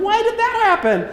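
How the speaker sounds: put-on the jumper wondering aloud like a child; clueless, high-pitched wonder; puzzled jumper. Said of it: "he turns around and goes".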